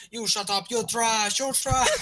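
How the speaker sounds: mock a heated Russian player's accent. Retelling a Russian opponent's insults, mock Russian accent. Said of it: "He had, like, some kind of accent".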